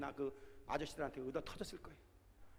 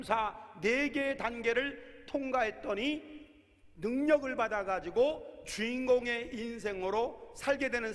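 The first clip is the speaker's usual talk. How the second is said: emphatic slow preaching; 6 semitones higher, 5 dB louder, slower than usual. Acted. Preaching at full force.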